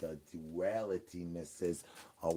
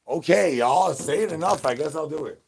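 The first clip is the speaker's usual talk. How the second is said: higher, loud put-upon sigh to chat; mock reluctant giving in; 6 semitones higher, 8 dB louder than usual. Streamer caving to chat's nagging, higher and put-upon. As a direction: act like the chat wore you down.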